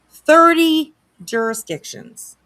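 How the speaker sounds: slow, high and loud disbelief; real shock, drawn out; stunned repeat. Real, not acted. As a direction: drag out each word, aghast.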